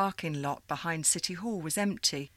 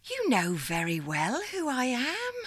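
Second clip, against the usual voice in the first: put-on old operator lady; 7 semitones higher than usual. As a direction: greet her like a fond old supervisor. Miss Liza teasing.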